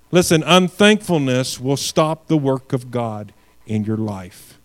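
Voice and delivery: ordinary talk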